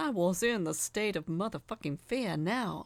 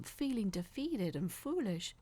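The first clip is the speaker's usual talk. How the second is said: plain reading voice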